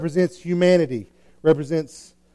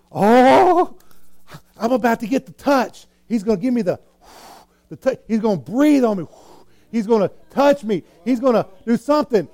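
acting out eager anticipation, higher voice; excited; 6 semitones higher than usual. Blind man awaiting the healing touch, acted excitement. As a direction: gasp and gush in hopeful expectation. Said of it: "So the guy gets excited".

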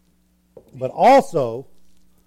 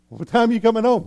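higher-pitched mock parental nagging; exasperated parent; 5 semitones higher, faster than usual. Parent asking about the messy room, mock exasperation. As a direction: demand an explanation from the kid.

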